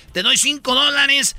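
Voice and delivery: hustler's offer. Retold or imitated. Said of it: "Y te dicen".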